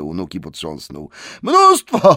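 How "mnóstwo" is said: very high, loud yelp; frightened shout. Acted. Bilbo, shaken by troll, yells in fright. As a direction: blurt it out in fright. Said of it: "krzyknął Bilbo".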